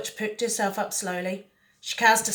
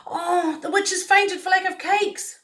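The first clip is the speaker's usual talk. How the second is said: put-on higher, alarmed child's cry; 10 semitones higher, 4 dB louder than usual. A narrator's reading. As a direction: call out in alarm for the witch. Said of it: "he cried".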